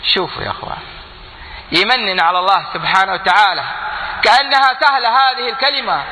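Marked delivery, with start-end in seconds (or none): 4.2-6.0 high ringing sermon voice; impassioned preaching. Real, not acted. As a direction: rouse the crowd.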